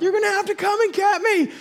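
very high-pitched cry for rescue; hammed-up desperate holler; panicked yell. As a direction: yell for help at the top of your range. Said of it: "and I yell out".

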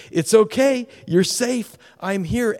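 the Lord comforting the believer, ringing and sure; the Lord's assurance. Acted. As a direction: proclaim the reassurance with joy. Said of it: "touches you and says this".

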